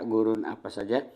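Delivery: ordinary talk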